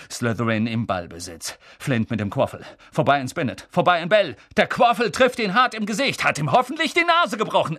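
lively sports commentary, rising to a gloat; match commentator. Acted. Lee commentating the Quidditch match, excited and partisan. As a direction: call the play fast, like a stadium announcer.

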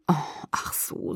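very soft, slow, almost whispered; hushed, subdued realisation; quiet. A narrator's reading. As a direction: say it low-key, nearly under your breath. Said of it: "sagte ich leise".

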